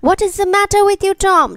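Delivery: rushing out the question in fright; crying out. A narrator's reading. Aunt Polly cries out to Tom in alarm, loud and fast. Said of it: "she cried".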